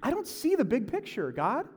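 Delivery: high, loud, strained griping; high-pitched exasperated rant; frustrated complaint. Retold or imitated. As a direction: whine it out, overwhelmed and confused. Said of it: "you're like".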